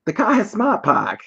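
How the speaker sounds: high, hammed-up Boston voice, "Park" said "Pahk"; Boston accent. Host imitating Boston people in a Super Bowl ad. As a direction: ham up a Boston guy bragging about his car. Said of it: "a bunch of people saying".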